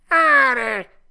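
very loud, high wailing cat; echoing cat. A narrator's reading. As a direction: yowl just the tail of the word. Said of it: "ripeté il gatto". (gatto che fa eco con un lamento fortissimo)

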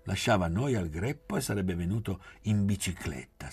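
usual speaking voice

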